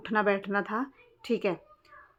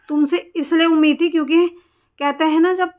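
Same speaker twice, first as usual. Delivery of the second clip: heated reproach; 5 semitones higher, 5 dB louder than usual. Real, not acted. Scolding the neighbour directly.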